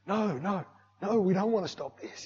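high, pleading refusal; retold protest. Worshipper inwardly begs pastor to continue, protesting. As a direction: object high, as an inner protest.

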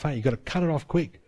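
ordinary delivery

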